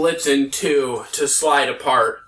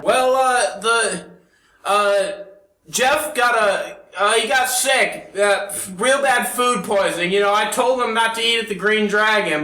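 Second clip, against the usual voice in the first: higher, hesitant, fumbling excuse; nervous rambling; 7 semitones higher than usual. Acted. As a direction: stall and ramble, making it up. DM voices impostor excuse.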